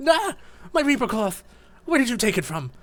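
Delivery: snatch at it with delight; excited gasp. Acted. Duskull reclaiming his reaper cloth, excited high voice.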